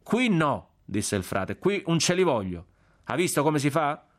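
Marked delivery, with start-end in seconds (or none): 0.0-0.7 high-pitched, indignant insistence; firm, affronted no; emphatic refusal. A narrator's reading. Friar firmly insists on his church. 1.6-4.1 higher-pitched, indignant appeal; friar's lively, affronted grumbling; aggrieved friar. A narrator's reading. Narrator voices the indignant friar.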